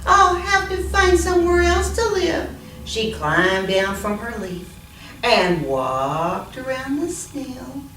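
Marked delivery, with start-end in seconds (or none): 0.1-2.5 higher small-bug voice; little ladybug. Acted. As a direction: sound like a tiny worried bug.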